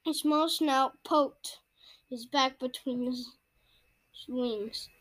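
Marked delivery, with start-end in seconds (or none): none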